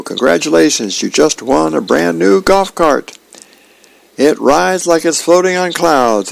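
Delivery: high-pitched salesman's congratulations; excited prize caller. A narrator's reading.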